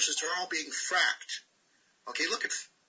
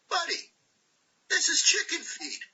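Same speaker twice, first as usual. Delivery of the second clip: much higher, scoffing voice; mocking put-on voice; 12 semitones higher than usual. To Trump about his money, a high mocking scoff. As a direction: scoff at it in a squeaky mock voice.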